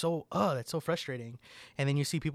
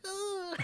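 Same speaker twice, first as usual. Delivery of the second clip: imitated frustrated yell; 5 dB quieter than usual. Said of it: "And he's like".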